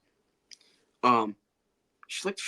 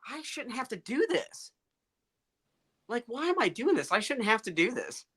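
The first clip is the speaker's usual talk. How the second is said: much higher-pitched fed-up protest; retold exasperation; 10 semitones higher than usual. Speaker recalls getting tired of hormone shots, exasperated. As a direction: complain to yourself in a high, exasperated voice.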